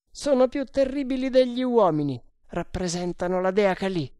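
high, emphatic character voice; ruthless sergeant. Acted. Sergeant condemning the women as worse, ruthless.

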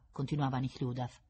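ordinary delivery, nothing marked

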